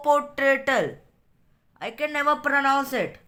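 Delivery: slow reading with a touch of impatience; out of patience. A narrator's reading. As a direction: drag out the complaint, mildly fed up. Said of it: "quite out of patience".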